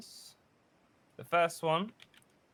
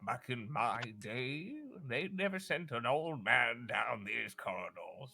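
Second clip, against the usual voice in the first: put-on mild old sage; 5 dB quieter than usual. Old sage shuffling down the corridor, lightly voiced old man. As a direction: grumble gently like an old sage. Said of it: "he goes".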